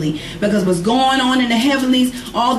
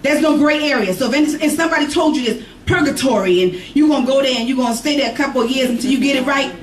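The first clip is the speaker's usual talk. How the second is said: raised, insistent preaching voice; 5 semitones higher than usual. Acted. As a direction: preach it higher and harder. Preacher builds intensity.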